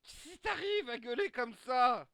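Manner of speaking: loud, high, slow drawn-out yell; shouting back. Acted. Teammate shouting back at the yelling character. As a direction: yell it back, stretching the words. Acted bickering after the yell.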